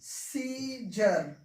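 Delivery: slow model word for the class; model pronunciation. Shown as a demonstration. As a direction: give सीजर slowly as the model word, no sound for the E. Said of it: "क्या पढ़ना है?".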